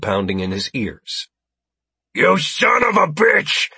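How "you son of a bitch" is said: high, loud, slow furious shout; yelling. Acted. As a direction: scream it at the traitor. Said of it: "he yelled at Jared Hale".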